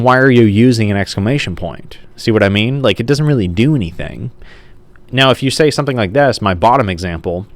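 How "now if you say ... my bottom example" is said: usual speaking voice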